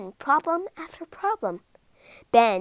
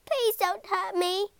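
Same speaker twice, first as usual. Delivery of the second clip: high, small pleading monster voice; pleading squeak from a tiny trapped monster; 10 semitones higher than usual. Acted. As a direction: squeak it out like a tiny frightened creature. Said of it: "squeaked a tiny voice".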